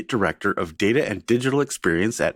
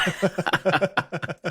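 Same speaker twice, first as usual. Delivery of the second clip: high laugh; 8 semitones higher, 4 dB quieter than usual. Real, not acted. Host laughing at own joke.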